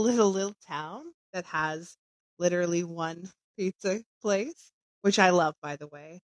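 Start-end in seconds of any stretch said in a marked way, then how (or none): none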